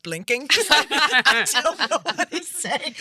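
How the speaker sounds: usual speaking voice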